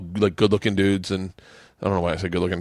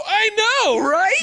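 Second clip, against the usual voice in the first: very high, squealed agreement; hammed-up amazement, pitched way up; mock excitement. Performing the play's sandwich dialogue, squealed mock excitement. Acting out silly play dialogue.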